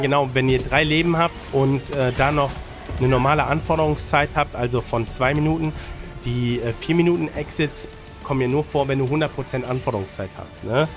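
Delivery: ordinary talk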